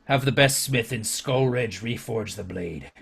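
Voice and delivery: low, measured warlord voice; deep, restrained chieftain with deliberate pauses; commanding orc chieftain. Acted. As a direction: give the order gravely and quietly. Chieftain gives an order.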